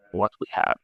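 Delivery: ordinary delivery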